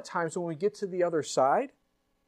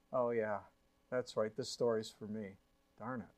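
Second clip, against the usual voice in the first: sheepish realization; 8 semitones lower, 10 dB quieter than usual. Acted.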